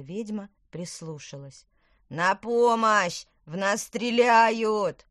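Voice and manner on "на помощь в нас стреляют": slow, drawn-out shout for help; higher, very loud calling from afar; desperate yell. A narrator's reading. Twins under fire call the witch, desperate yell. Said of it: "завопили, что есть сил".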